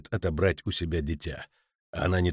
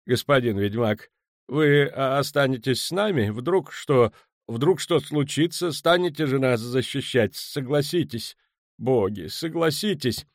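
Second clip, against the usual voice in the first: high, halting, anxious plea; nervous high-pitched begging; frightened merchant pleading; 7 semitones higher, 3 dB louder than usual. Acted. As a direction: beg the witcher to protect you. Frightened merchant begs for protection.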